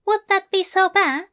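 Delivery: a prim bookish angel confessing lightly; Aziraphale voice. Acted. Aziraphale asking softly.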